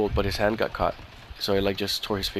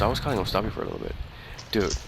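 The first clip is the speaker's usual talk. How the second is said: ordinary talk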